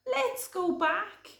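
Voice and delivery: high, sing-song wailing rabbit; fretful cry. Acted. Podgy crying to Hare about bear hunting, high wail. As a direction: whine about the hunt.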